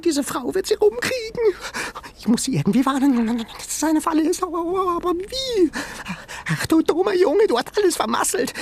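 very high, fast frantic animal voice; frantic high creature voice; high panicky creature. Acted. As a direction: squeal it in a panic, scolding the boy. Sengi panicking.

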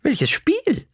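hohe Omastimme, ahnungslos fragend; verwunderte Oma. Acted. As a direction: frag wie eine Oma, die nichts versteht. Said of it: "hat Oma Otto sich gewundert". (verwundert, hohe Omastimme)

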